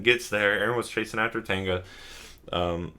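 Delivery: ordinary talk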